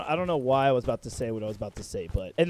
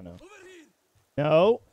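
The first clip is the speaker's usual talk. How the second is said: normal talking voice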